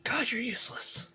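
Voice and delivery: breathy whisper under breath; whispered aside. Real, not acted. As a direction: whisper it so nobody hears. Aside muttered about the farmer.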